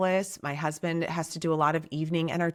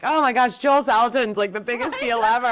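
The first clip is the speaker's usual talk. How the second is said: fangirl gush; 6 semitones higher, 6 dB louder, faster than usual. Retold or imitated. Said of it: "And I'm like".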